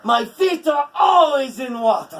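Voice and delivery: high, loud proud sea-god voice; Njord crying out. A narrator's reading. Njord defending his feet, proud and loud. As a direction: boast it grandly. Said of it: "Njord cries".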